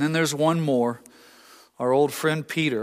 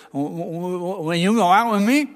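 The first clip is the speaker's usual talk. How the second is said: stuttering ask; 3 semitones higher than usual. Retold or imitated. Stuttering suitor asks girl out.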